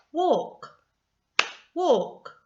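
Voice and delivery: WALK high and loud, falling on one beat; one clapped beat. Shown as a demonstration. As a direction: punch WALK as a single syllable. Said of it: "I'm going to clap as I say the word".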